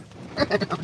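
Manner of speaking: high chuckle; laughing. Real, not acted. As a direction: chuckle at your own joke. Laughs at own wrestler voice.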